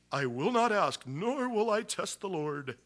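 high, slow, prissy pious refusal. Retold or imitated. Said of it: "You have to say it like that".

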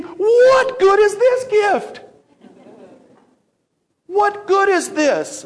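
high, loud, drawn-out mock wail; anguished wail. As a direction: cry out slowly in exaggerated despair. Retold anguished thought about his gift.

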